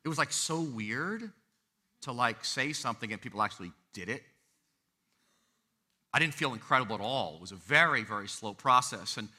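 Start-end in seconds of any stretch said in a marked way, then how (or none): none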